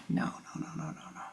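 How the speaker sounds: whispered no no no, barely audible; hushed denial. Acted. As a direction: brush it off in a near-silent whisper. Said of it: "And the other three say".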